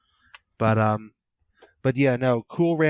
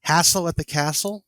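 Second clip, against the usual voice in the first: Castle with a flat American a, rhyming with Hassle; American accent; 4 semitones higher than usual. Shown as a demonstration. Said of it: "I want you to say it".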